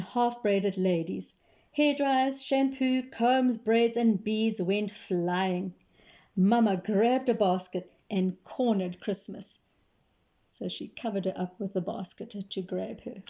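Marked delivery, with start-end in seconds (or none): none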